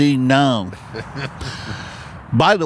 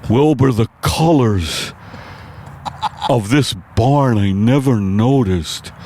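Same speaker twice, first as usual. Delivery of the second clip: Mister Ed tripping; slower than usual. Acted. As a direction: marvel at the red barn slowly. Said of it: "my impersonation of Mister Ed, on acid".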